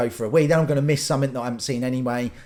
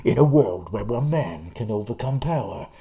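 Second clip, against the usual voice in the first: low movie-trailer announcer impression; trailer voiceover guy; 5 semitones lower than usual. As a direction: intone it like an epic film trailer. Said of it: "ninety percent of the voiceovers for the trailers".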